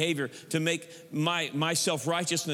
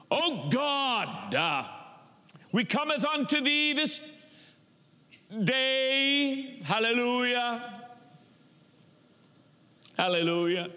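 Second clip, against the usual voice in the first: mock churchy prayer with drawn-out words; affected prayer voice; 5 semitones higher, slower than usual. Preacher mimicking ministers who pray unnaturally, affected prayer voice. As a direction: parody an overwrought, put-on praying style. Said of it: "Making faces and words and sticking an "ah".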